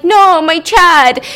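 hammed-up despair over his friend; anguished cry. Chad cries for fallen partner.

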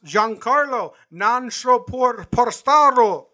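high, slow hammed-up broadcaster call; drawn-out Italian home run call; John Sterling home run call. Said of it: "I think he said the wrong Italian word".

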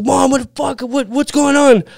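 frantic, can't believe what's outside; panicked. Retold or imitated. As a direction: son seeing swat on rooftops, panicked high plea to mom. Panicked after seeing swat.

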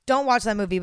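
normal talking voice

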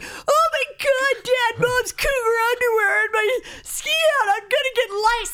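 very high, squealing kid in hammed-up horror; mock horrified shriek. Son yelling at dad about mom's underwear in his helmet, hammed-up horrified shriek. Son's horrified outburst at airport, retold.

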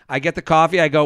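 normal talking voice

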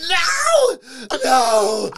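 high, loud laughing wail; hammed-up devastated no; mock anguished cry. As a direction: cry out in comic despair. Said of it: "I'm like".

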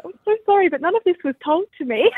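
high, loud, flustered sorry; flustered apology. Retold or imitated. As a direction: blurt out an alarmed apology. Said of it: "I was like".

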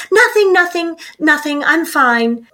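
high, quick "I'm fine" denial; a parent hiding grief behind a breezy nothing; mock brush-off. Grieving mom brushing off child.

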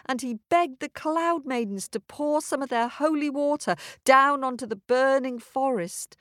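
ordinary delivery, nothing marked

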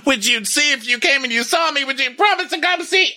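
wife begging her husband to watch her, rising mock whine; whiny pleading wife. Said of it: "And she's like".

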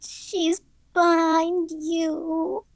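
put-on very high, slow little-girl wail; a scared child crying out a warning, drawn out; high, stretched-out child's warning; frightened cry. A narrator's reading. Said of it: "she cried".

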